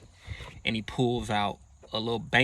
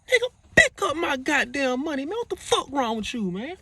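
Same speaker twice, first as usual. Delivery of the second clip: much higher, louder angry yell; furious yell; 4 dB louder than usual. Retold or imitated. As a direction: yell at him to pick it up. Cash thrown at his chest.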